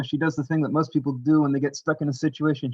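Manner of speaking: plain reading voice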